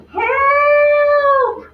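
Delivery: a high, loud, long "Help!"; distant cry for help. Acted. Child stuck on the monkey bars crying out, loud and high. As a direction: cry out from far away. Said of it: "cried a child off in the distance".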